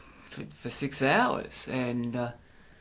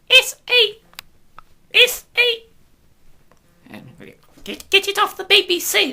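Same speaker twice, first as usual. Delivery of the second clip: much higher, louder mock of scandalised censors; mocking outraged prude; 9 dB louder than usual. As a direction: ham up a prim busybody's horror.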